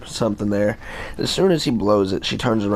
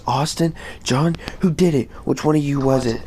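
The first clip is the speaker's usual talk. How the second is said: lightly voiced, higher-pitched teacher demanding answers; retold teacher interrogation, pitched up; accusing teacher; 6 semitones higher than usual. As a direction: imitate a teacher questioning the culprits. Teacher demands who did it.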